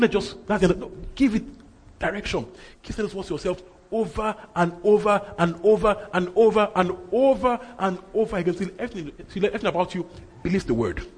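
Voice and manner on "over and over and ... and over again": OVER said slowly in a high, rhythmic chain; insistent repetition. Shown as a demonstration. Preacher urging them to keep saying the words, insistent. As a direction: hammer each OVER in an even, drawn-out rhythm. Urges endless repeating by repeating.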